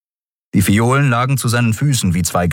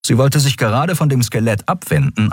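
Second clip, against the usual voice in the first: plain reading voice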